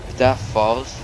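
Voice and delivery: alarmed. Real, not acted. Alarm at falling staircase.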